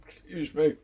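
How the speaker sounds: hiccuping mid-sentence; hiccups. Real, not acted. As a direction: speak through the hiccups. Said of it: "oh, I've got the hiccups now".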